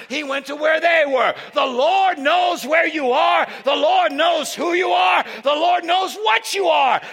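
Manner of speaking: sermon building to a loud, high shout; preaching, building. Real, not acted.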